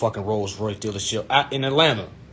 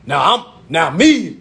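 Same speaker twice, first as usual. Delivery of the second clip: ordinary delivery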